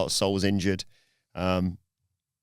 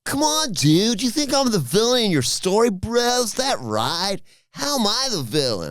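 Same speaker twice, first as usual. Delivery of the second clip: Californian bro voice; 12 semitones higher, 3 dB louder than usual. Retold or imitated. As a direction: play Sol as a whiny Californian bro. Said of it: "And Sol says".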